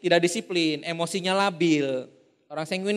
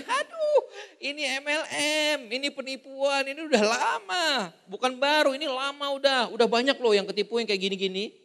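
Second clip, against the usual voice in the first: high, laughing scornful prospect; mock scornful laugh; 5 semitones higher, 4 dB quieter than usual. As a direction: deride the offer as a scam. Sanguine prospect mocking MLM. (mengejek sambil tertawa, nada tinggi)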